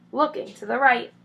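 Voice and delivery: ordinary delivery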